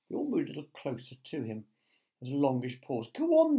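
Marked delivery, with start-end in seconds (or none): 3.1-3.6 high, loud urging; urgent shout; frantic cry. A narrator's reading. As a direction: press him desperately. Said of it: "they cried frantically".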